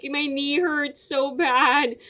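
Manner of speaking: slow, mild mock whimper; imitating whimper. Crying girl telling her friend about her knee, slow mild whimper. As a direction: drag the words out like a hurting kid.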